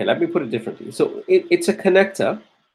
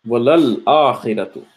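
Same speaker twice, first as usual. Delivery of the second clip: Quranic recitation; 5 semitones lower, much faster than usual. Acted.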